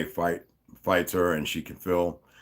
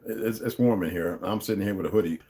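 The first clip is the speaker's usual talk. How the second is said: usual speaking voice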